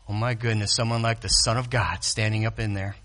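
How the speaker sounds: awed hush. Real, not acted. Preacher marveling at the Son of God, hushed. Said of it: "awed aside".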